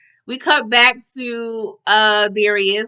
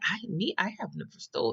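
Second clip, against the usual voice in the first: mock hushed denial; 4 semitones lower, 12 dB quieter, faster than usual. Vanessa denying the theft.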